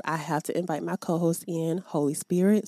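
ordinary talk

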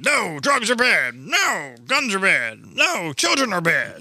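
high, drawn-out scolding; mocking Jersey. Comic voicing a state that forbids everything, mock prim. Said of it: "Jersey was like".

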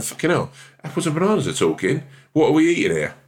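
high, stunned outburst; shocked disbelief. Retold or imitated. Visitor gawping at talking fruit, high and shocked. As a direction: react like fruit just spoke to you. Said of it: "I'm going".

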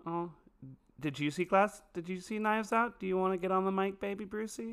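high-pitched cooing questions; pet talk. Pretend. Podcaster asks baby Brucey onto the mic, high pet talk.

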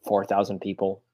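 normal talking voice

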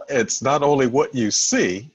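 ordinary talk

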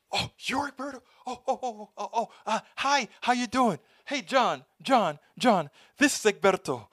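high-pitched, flustered sudden friendliness; mock fawning surprise. Clerk realizes the man is Egberto, high flustered fawning. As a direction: gush like a clerk caught out.

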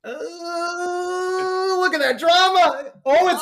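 milk the reveal like a game-show host; dramatic. Pretend. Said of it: "I'm nothing if not dramatic".

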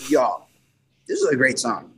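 normal talking voice

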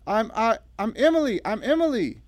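high, loud, stammering distress; trembling. A narrator's reading. Revived Emily telling Natalie her name, trembling. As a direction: stammer it out in panic. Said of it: "trembling violently".

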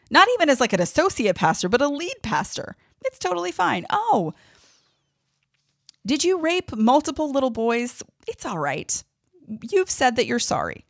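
high, sarcastic disbelief at churches excusing abusers; mock it sky high with outrage. Real, not acted. Podcaster mocking churches that excuse abusers, high sarcastic outrage.

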